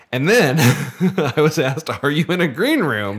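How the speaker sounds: laughing while telling it; can't stop giggling. Real, not acted.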